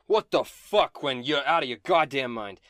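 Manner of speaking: loud, acted disbelief; indignant outburst, hit hard at the start; outraged reeling victim. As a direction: snap back after being punched. Struck villain reels at Quinn.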